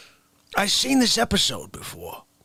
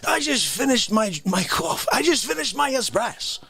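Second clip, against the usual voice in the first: ordinary talk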